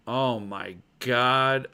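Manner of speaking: normal talking voice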